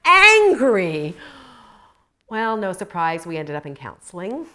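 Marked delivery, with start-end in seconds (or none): 0.1-1.2 much higher and louder mock husband; hammed-up husband saying you're so angry; husband's line. Husband to wife mid-fight, loud mock chiding. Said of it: "And he was like".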